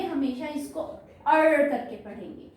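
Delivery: ordinary talk, nothing marked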